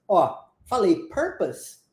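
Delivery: "purpose" said slowly, the English way; English pronunciation. Shown as a demonstration. Teacher models "purpose" for a student, slow and careful. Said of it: "tem dificuldade com a palavra purpose".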